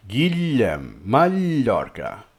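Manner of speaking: GUILLEM and MALLORCA with a long, drawn-out palatal LY; Catalan double L. Shown as a demonstration. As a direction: stretch the LL in "Guillem, Mallorca" slowly. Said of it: "this sound, the double L".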